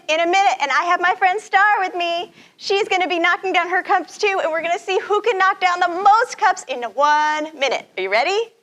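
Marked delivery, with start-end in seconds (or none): none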